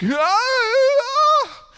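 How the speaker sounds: high wailing cry, imitated. Imitating the man crying on the floor, high wail. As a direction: mimic the man's wail on the floor.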